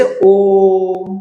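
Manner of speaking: long stretched om; ओम stretched to three beats; drawn-out om. Shown as a demonstration. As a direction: hold the ओम long. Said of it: "उसमें timing लंबा खींचते हैं".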